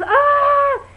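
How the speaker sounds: high, loud mock scream; imitated startled scream. Imitating teacher hit with whipped cream, mock scream. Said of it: "and he goes, he goes".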